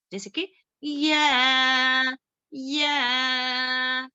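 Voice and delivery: या drawn out slowly for four full beats; four-count elongation. Shown as a demonstration. Teacher shows students how long to hold या, slow. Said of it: "इसको चार हरकत के बराबर खींचेंगे".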